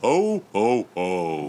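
big jolly ho ho ho; belly-laugh Santa voice. Pretend. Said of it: "Give me your best ho, ho, ho".

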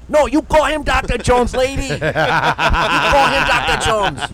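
loud, high, hammed-up scolding that slows to a drawn-out finish; mock indignant shout. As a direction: holler the correction across the room, playfully outraged. Acts out shouted correction to coworkers.

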